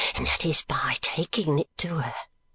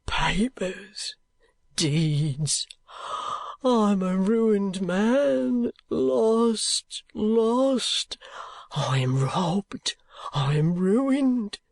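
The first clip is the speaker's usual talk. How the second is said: high, very slow broken lament with whispers; raving old miser; 6 semitones higher, much slower than usual. Acted. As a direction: fall apart in despair. Gride raving.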